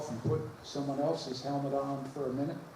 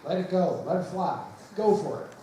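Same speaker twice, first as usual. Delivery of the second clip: louder, higher, punchy cheer; rousing send-off; 4 semitones higher, 6 dB louder than usual. Real, not acted. Rector sending the night's performers onstage, rousing cheer. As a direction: cheer them on in short bursts.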